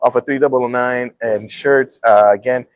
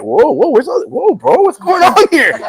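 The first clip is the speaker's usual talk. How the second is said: defensive Mencia; 12 semitones higher, 4 dB louder than usual. Retold or imitated. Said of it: "And he was like".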